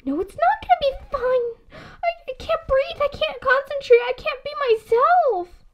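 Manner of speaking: high, half-laughing half-crying panic; panicky whimper. Acted. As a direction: gasp it out, losing control. Panic attack before meeting idol.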